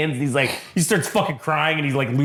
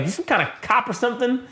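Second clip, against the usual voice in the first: mock thug; 9 semitones higher than usual. Thug asking Cole.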